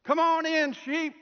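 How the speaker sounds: big high-pitched holler; loud preacher call. Retold or imitated. Chief shepherd showing how to call sheep, loud preacher voice. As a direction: project it like a preacher calling across a field. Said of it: "Put on your best preacher voice".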